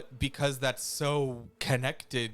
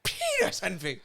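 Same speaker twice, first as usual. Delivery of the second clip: high, fast words through real laughter; laughing; 12 semitones higher than usual. Real, not acted. Friend cracking up over the penis envy joke, laughing. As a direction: giggle the words out quickly.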